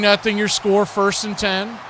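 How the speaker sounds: ordinary delivery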